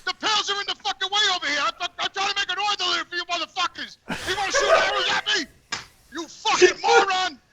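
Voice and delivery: high, exasperated mock tirade; retold yelling. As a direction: replay yelling at a kid, half laughing. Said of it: "I yell up the window".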